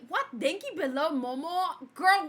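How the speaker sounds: ordinary talk, nothing marked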